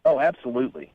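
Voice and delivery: ordinary delivery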